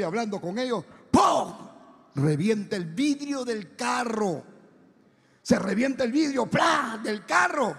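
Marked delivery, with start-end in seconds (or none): 1.1-1.5 ¡pum! súbito, fuerte y agudo; estallido imitado. Retold or imitated. Imitates car window bursting. 6.4-7.0 ¡plá! agudo y repentino; vidrio que revienta. Retold or imitated. Imitates glass bursting.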